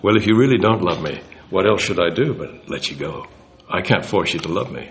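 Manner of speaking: put-on a suitor letting the girl go, softly; like a gentleman. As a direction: let her go kindly and quietly. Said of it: "like a gentleman".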